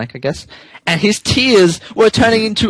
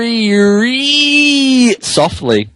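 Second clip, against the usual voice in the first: slow; 5 semitones higher, much slower than usual. Shown as a demonstration. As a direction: stretch it out gently. Reading acts out softly.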